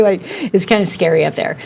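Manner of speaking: ordinary talk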